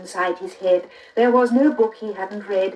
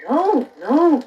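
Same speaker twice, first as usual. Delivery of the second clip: put-on higher, louder boyish no; Jack protesting; 7 semitones higher, 4 dB louder than usual. A narrator's reading. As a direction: protest eagerly like a boy. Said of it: "cried Jack".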